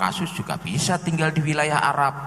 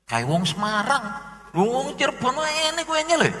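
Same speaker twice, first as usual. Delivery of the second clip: climbing high in surprise; mock doubt; 9 semitones higher than usual. The local doubting the claimant's cake, high mock surprise. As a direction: exclaim the doubt rising high.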